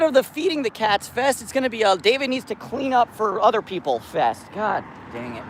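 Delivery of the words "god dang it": soft frustrated mutter under breath; quiet annoyed mutter; muttered curse. Real, not acted. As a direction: grumble it much softer. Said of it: "Or sorry, God love it".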